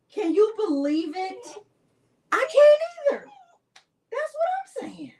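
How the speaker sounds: high excited squealing; real thrilled disbelief. Real, not acted. Host celebrating two winners with viewers, high incredulous squeal.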